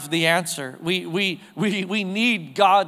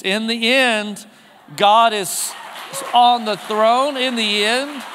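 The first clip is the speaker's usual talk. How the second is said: raised, higher preaching voice, building; rousing proclamation; 4 semitones higher than usual. Real, not acted. Preacher assures hurting congregation God wins, rousing proclamation. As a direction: proclaim it slowly, rising in pitch and force.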